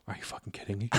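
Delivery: voiceless, stunned whisper; mock whisper. Mocking a man waking to the mask news, whispered disbelief. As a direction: hiss it in disbelief.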